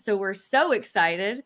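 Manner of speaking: ordinary talk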